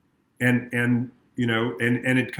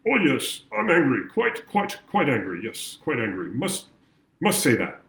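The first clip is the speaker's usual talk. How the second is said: stiff-upper-lip British. Retold or imitated. Therapist mocking bottled-up anger with a mock British accent. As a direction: play the polite Englishman barely admitting anger. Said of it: "that British stereotype of".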